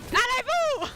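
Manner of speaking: high shouted French call; loud French cheer. Acted. French caster turn.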